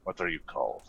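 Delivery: low, quiet stranger's voice; merchant voice. Acted. GM voicing the merchant NPC.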